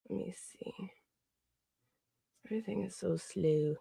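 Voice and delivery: breathy aside under the breath; whispered mutter while busy. Real, not acted. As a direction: whisper to yourself.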